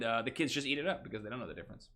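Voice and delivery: ordinary delivery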